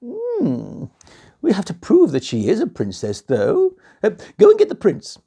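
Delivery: high, lively royal queen; a bossy queen giving orders. Acted. Queen speaking to the king, high and lively. Queen voiced in bedtime story.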